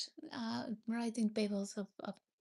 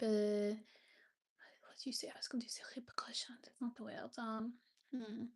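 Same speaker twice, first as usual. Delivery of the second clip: hushed, half-whispered word search; muttering to herself; 6 dB quieter than usual. Real, not acted. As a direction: think aloud under your breath, much softer.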